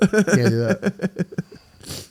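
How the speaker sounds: slow, giggly words; stretched words with laughter in them; laughing through words. Real, not acted. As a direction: say it while still cracking up. Laughing at the bit.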